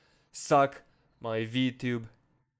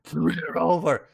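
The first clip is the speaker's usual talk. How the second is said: angry louder order; 3 semitones higher, 6 dB louder than usual. Real, not acted. Telling viewers to read it again, louder in exasperation.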